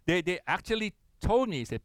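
ordinary talk, nothing marked

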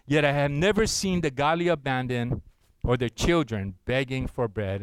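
congregational scripture reading. Acted. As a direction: lead the room through the verse. Congregation reads psalm together.

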